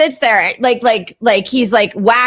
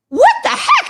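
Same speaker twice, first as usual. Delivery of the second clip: very high, incredulous squeal; exasperated, pitched-up reenactment; retold disbelief. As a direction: voice shoots way up in mock outrage. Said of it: "And I'm going".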